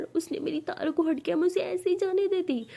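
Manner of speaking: breathy tearful lines rising high; crying alone over losing her girlfriend. Acted. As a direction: break down in tears under the blanket. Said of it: "बहुत रो रही होती है".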